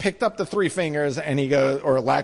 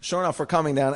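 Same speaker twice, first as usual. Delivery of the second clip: ordinary talk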